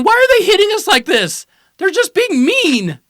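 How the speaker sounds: high, loud whiny mock crybaby; mock crying. Buffs whining about getting hit, mock crying. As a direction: wail it in a high mock-tearful whine, drawing out the end. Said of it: "all started crying".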